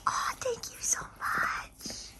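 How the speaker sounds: very high soft whisper. Real, not acted. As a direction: breathe the thanks to the dog. Thanking dog for kisses.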